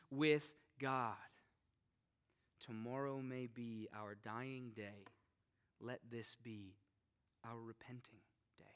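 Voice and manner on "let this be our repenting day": soft whispered plea; hushed whisper. Real, not acted. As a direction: drop to a whisper. Sermon drops to hushed plea.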